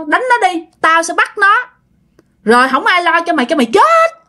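high-pitched scolding refusal; angry ghostly father. Acted. Possessed grandmother refuses to go near the altar, angry high scolding. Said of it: "ông cố mới trả lời vậy nè".

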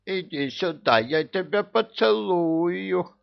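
high, slow cooing; lisping baby talk. A narrator's reading. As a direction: coo at her in baby talk. Cooing to the bride. (сюсюканье)

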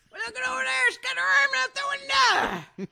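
loud high yell of a street man; hammed-up street shouting. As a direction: shout it across the street at the car. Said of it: "And there's man started yelling".

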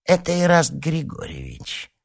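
high, squeaky villain on the phone; high-pitched creaky little voice; thin creaky voice. A narrator's reading. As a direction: introduce yourself in a thin creak. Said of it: "раздался тонкий скрипучий голосок".

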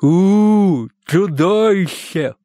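slow, loud monster howl; gurgling monster. Acted. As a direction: play the monster, drawn out. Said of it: "пробулькал он". (булькающее чудовище)

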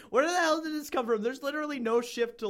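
high, fast real disbelief; quick, high-pitched baffled outburst; exasperated bafflement. Real, not acted. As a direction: break off reading in incredulous protest. Reader baffled by the sudden shift.

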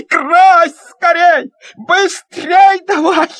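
high, loud, slow urging; eager shout. Acted. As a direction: urge him on, all excited. Boy urging painting on.

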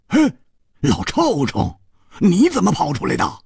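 put-on high, startled outcry at his old friend; blurting out in surprise. Hu Tiehua startled to see his friend, blurting out. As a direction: blurt it out, caught off guard. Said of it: "胡铁花失声说".

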